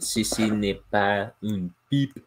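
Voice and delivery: slow, exaggerated French accent; French reading voice. Shown as a demonstration. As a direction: read it like a French art label. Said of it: "put on my reading French voice".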